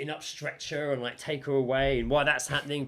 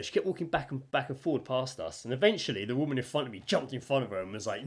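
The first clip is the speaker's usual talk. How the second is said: ordinary delivery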